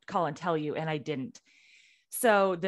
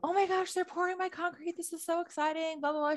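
imitating mom, much higher and giddy; excited mom; 12 semitones higher, faster than usual. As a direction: gush like a thrilled mother showing off her driveway. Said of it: "and she's like".

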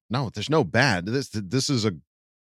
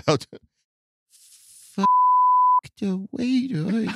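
put-on high, slow baby-doll voice; 13 semitones higher than usual.

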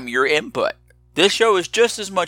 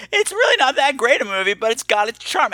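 high-pitched mimicry of a caller; imitating the caller; 7 semitones higher than usual. Podcaster mimicking a caller's movie verdict, imitating in a high pitch. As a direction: quote him in a raised, put-upon pitch. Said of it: "and said".

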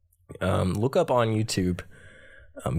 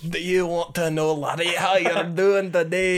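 higher mock Scottish with a laugh; Scottish accent; 8 semitones higher than usual. Friend joining the Scottish bit, higher mock brogue. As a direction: ham up a Scottish uncle. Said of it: "when I do a Scottish".

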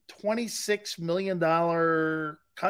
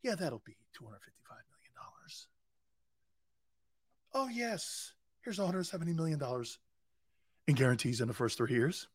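whispered, soft sly offer; hushed mock team voice; 2 semitones lower, 11 dB quieter than usual. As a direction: hush it like a sly deal across the table. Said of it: "The Eagles were like this".

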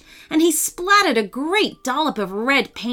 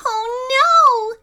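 high, loud, dismayed squeal; frightened little bat cry; 13 semitones higher, 4 dB louder than usual. A narrator's reading. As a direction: cry out in alarm like a tiny bat. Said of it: "said Bitsy".

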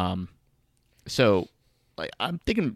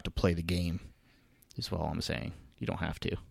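soft unvoiced whisper; whispered aside; 3 semitones lower, 10 dB quieter than usual. Real, not acted. Telling the audience they don't have to, whispered. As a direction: breathe it as a private aside.

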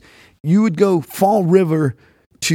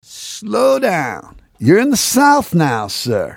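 slow, high Southern drawl; mock Southern accent; 4 semitones higher, much slower than usual. Southern clerk schooling a Northern customer, slow drawl. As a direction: stretch it out as a Southern scolding. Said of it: "Everything's real fucking slow down there".

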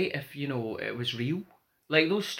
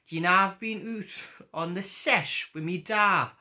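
put-on Geordie accent; 5 semitones higher, slower than usual. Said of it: "I'm hamming up my Geordie accent".

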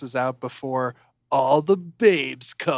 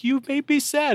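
much higher and louder, a pouty hurt wife; wife telling Bam she is hurt, hammed-up and pouty; mocking the wife; 13 semitones higher, 5 dB louder than usual. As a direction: whimper it like a sulking child. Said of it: "And she's like".

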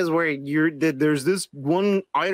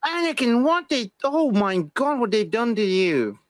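higher, slower mock British accent, lightly done; hint of a British accent, pitched up and drawn out; mock British Obi-Wan; 4 semitones higher, slower than usual. Echoing his friend's Obi-Wan line in a mock British accent. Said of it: "in a British voice".

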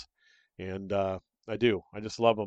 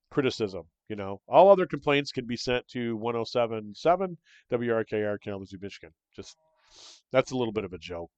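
normal talking voice

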